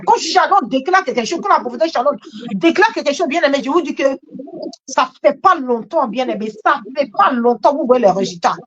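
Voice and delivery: usual speaking voice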